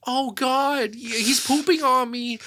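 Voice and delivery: high, whiny cartoon dad; cartoon dad Peter whining high-pitched at his creepy neighbor. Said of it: "And he goes".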